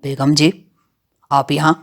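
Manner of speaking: lightly voiced low-pitched bandit; a hint of a brazen outlaw's greeting; smirking bandit. A narrator's reading. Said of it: "گھنی مونچھوں تلے مسکرا کر بولا".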